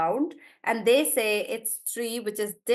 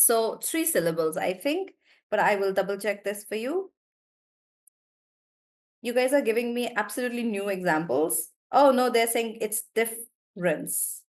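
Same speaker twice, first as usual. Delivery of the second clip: usual speaking voice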